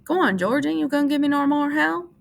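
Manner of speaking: high, eager pleading. A narrator's reading. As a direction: urge him on eagerly. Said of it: "Lenny looked eagerly".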